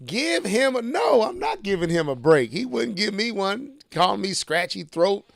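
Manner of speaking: ordinary talk, nothing marked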